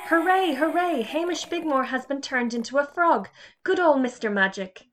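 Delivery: high, loud, gleeful cheer; children cheering that the bully is a frog. Acted. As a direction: celebrate like a delighted class. Class cheers Hamish's fate.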